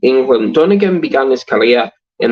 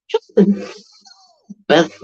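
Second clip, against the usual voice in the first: Yorkshire impression; 6 semitones higher than usual. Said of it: "Yorkshire, and she goes".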